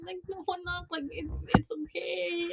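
soft, high motherly comfort; imitated soothing mom. Mom comforting crying daughter, imitated gentle soothing.

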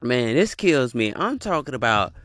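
ordinary talk, nothing marked

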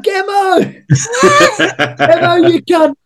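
high shouted call of a nickname, laughing; loud greeting. Retold or imitated. Ali Williams shouting across at the Sevens, high laughing call. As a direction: bellow the nickname across the room.

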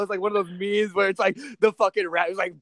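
usual speaking voice

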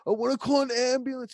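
high, loud panicked yelp; mock freak-out. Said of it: "freaking the fuck out".

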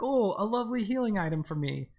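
high, chirpy mock delight. Retelling own naive joy at the herb, high and chirpy. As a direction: gush over the item in mock joy. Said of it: "I was like".

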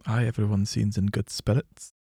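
put-on low, soft Scottish accent. Player reporting on the working people, low Scottish accent. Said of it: "apologise to the people of Scotland".

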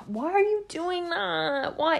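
high-pitched, drawn-out squeal of complaint; mock disgusted whine. Asking herself why she cries, mock-whiny. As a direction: put on a whiny, fed-up voice, stretched slow.

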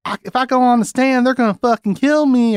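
mock panicky kid, very high; high-pitched imitation of a child actor; twelve-year-old boy. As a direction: plead it like a worked-up kid. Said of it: "he's, like, twelve. He's like".

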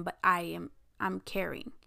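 usual speaking voice

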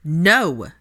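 loud, angry refusal; sharp, forceful denial; spat out. A narrator's reading. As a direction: spit the word back at him. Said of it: "she spit out".

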